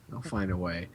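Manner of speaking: They'll find a way dropped low and soft. Shown as a demonstration. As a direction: repeat it under your breath.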